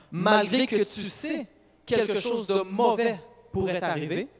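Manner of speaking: fervent preacher urging his listeners to stay faithful to God; preaching with force. Acted. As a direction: preach it hard so the congregation feels the stakes. Preacher drives home lesson.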